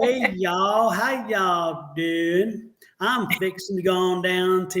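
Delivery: long, stretched-out Texas drawl; demonstrating a lazy-slow Texan accent. Acted. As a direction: draw out every word like a Texan. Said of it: "it's a very long, slow draw".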